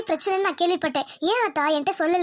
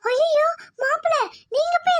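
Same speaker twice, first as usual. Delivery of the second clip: aghast protest; 8 semitones higher than usual. Acted. Mother-in-law refusing apology.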